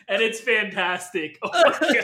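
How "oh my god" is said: laughing. Real, not acted. Laughter continues.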